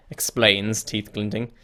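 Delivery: normal talking voice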